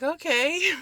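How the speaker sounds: feigned wide-eyed acceptance; hammed-up high-pitched agreement; squeaky okay. Retold reaction to odd news.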